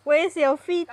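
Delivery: ordinary talk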